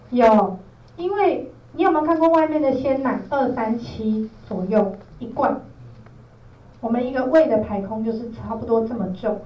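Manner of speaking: ordinary talk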